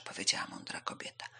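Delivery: plain reading voice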